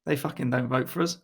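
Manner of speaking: normal talking voice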